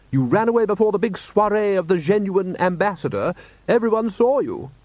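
high-pitched wifely scolding; Lady Sybil, reproachful wife. A narrator's reading. Wife reminding her husband he ran away, reproachful. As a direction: reproach him in a high, insistent voice.

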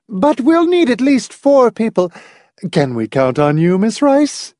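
high-pitched, insistent hostess; emphatic. A narrator's reading. Hostess recruiting a fourth player, emphatic. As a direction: press the point in a high matronly voice. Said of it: "was saying emphatically".

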